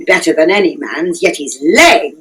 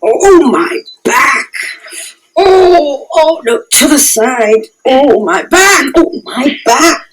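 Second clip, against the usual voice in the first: groaning about a sore back; aching complaint; 6 semitones higher than usual. Acted. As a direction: moan and whine in pain. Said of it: "how my head aches".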